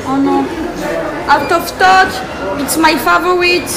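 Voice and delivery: usual speaking voice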